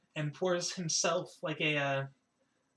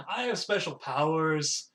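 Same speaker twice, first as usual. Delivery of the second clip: slow, loud, drawn-out boast; grand boast; 5 dB louder, slower than usual. Acted. Chisadri boasts over her drink, grand. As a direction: stretch it out proudly.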